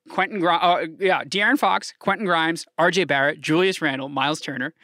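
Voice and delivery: usual speaking voice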